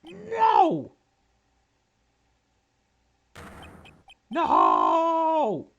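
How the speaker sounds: shrieked high, full-throated; anguished scream. Real, not acted. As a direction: scream out in despair. Losing the case, crying out.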